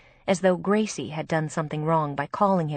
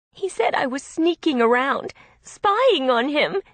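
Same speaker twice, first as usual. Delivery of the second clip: upset, tearful; 8 semitones higher, 6 dB louder than usual. A narrator's reading. Distressed sister recounting.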